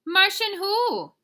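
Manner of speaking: high, very loud, drawn-out; hollered question. Acted. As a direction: call back across space for an answer. Scapito hollers back to the voice.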